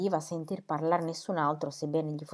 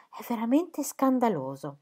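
higher-pitched outraged verdict; indignant; 6 semitones higher, 3 dB louder than usual. A narrator's reading. Sir James condemning the affair to his family, indignant. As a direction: protest with mounting indignation. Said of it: "ancora al culmine dell'indignazione".

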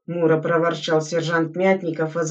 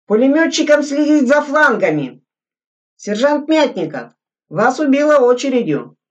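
loud, higher orders; commanding shout; 7 semitones higher, 5 dB louder than usual. A narrator's reading. Lieutenant directing the exercise, loud commands. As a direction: run the drill by shouting. Officer giving drill orders.

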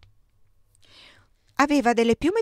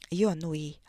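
ordinary delivery

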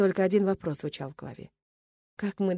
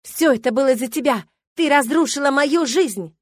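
loud, high, slowing for the blame; furious accusation; 6 semitones higher, 8 dB louder than usual. A narrator's reading. Milena strikes the table and blames her rival, enraged. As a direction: hurl the blame at full voice.

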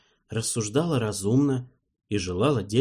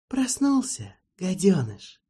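fake tenderness; 9 semitones higher, slower than usual. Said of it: "спросил Марбл с ласковостью".

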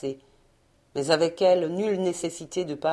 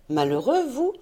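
incredulous; 6 semitones higher than usual. Acted. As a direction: throw his word back at him, astonished. She echoes his word in disbelief.

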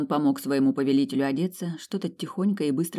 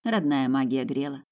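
ordinary delivery, nothing marked

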